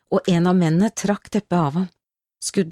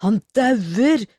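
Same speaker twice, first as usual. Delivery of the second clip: high-pitched, alarmed cry; boy crying out; 5 semitones higher than usual. A narrator's reading. Young boy warning that the man is dying, crying out. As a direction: call it out in alarm, pitch swooping up. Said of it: "ropte den unge gutten".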